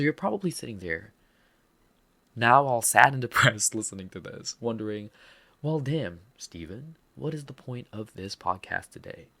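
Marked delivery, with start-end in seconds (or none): none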